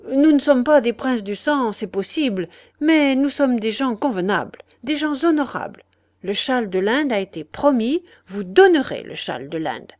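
the father lays down the law, loud and indignant; angry father. Acted. Father insisting to the old lady, loud and angry. Father angrily demands the shawl.

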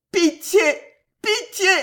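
very high, loud wail; anguished, high-pitched moan; moaned plea. A narrator's reading. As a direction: wail for mercy. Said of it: "gémit Alice, prosternée".

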